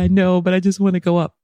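high, hurried begging; retold pleading. Grandmother begging to go upstairs, acted-out pleading. Pleading past closing visiting hours.

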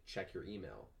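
ordinary delivery

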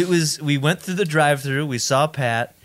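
normal talking voice

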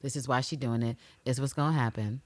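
soft dreading murmur; anxious hush. Retold or imitated. Viewer quietly dreading the gun, soft. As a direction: say it low with dread. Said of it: "but I was like".

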